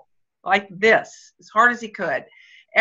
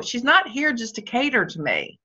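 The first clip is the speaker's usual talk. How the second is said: usual speaking voice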